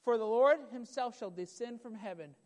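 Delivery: ordinary talk, nothing marked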